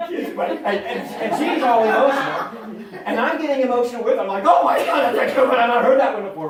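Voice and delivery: loud and high through laughter; loud excited laughing retelling; laughing loudly. Real, not acted. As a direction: laugh while telling it. Retelling emotional moment, laughing.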